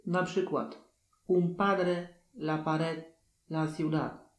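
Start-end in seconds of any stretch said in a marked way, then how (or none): 1.3-4.3 "la ciudad" with a final d that is almost silent; teacher's example words; Spanish d demo. Shown as a demonstration. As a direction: soften the d. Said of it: "na końcu jest prawie niesłyszalna".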